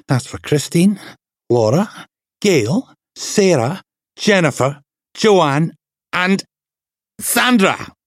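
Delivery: rising vengeful roll call of victims; vengeful outburst. Acted. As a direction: make him pay for every name. Kicking the attacker, naming victims.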